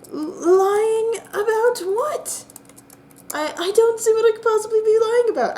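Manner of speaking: a flustered innocent who can't believe the accusation; panicked protest. Acted.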